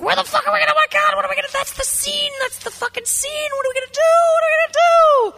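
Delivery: very high-pitched frantic mock rant; hammed-up fury. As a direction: squeal it in an infuriated panic. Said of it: "I was really angry".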